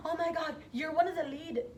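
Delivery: high, loud, near tears with excitement; excited friends. Retold or imitated. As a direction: gush the news in disbelief. Friends calling with big news.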